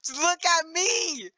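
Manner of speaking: very high, very slow whine; silly high drawn-out voice. Pretend. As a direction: stretch it out squeaky high. Demanding camera.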